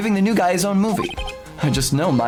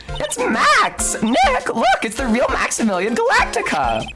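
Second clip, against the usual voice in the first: put-on excited girl voice; 9 semitones higher than usual. Voicing excited girl Maya.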